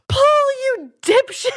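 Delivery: high, exasperated put-down; exasperated insult. Retold or imitated. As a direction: scold a hopeless idiot with mock disbelief. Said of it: "I'd just be like".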